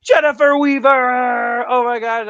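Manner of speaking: high, slow, loud name call; gushing. Real, not acted. To viewers, gushing about hugging Jennifer at the Clash. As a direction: cry her name out in delight.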